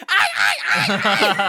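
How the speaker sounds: very loud, high squawks; raven caw. Acted. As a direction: shriek it like a big raven. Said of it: "a large raven will land".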